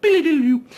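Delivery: a goofy vocal noise for bricks twisting by magic; silly magic noise. Retold or imitated. Villain twirls bricks with his mind, high loud goofy magic sound.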